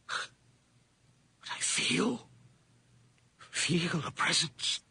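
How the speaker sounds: slow, soft, breathless dread; dread-filled hush. Acted. Old man sensing death in the room, slow soft dread. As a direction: stretch it out in dread.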